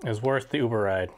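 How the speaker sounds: eating between words; chewing while talking. Real, not acted. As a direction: mouth partly full. Seen 3: bite taken at -4; eats from tray, mouth closed, chewing throughout.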